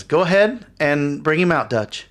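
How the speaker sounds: lightly voiced woman giving an order; commanding woman.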